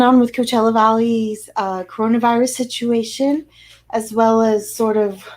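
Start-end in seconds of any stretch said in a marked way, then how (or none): none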